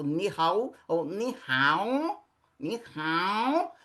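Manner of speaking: ni hao said with different tones, each take shifting the pitch. Shown as a demonstration. Teacher contrasts tones of ni hao for students. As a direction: show how the tone on ni hao changes its meaning. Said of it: "Dependendo da entonação que você dá".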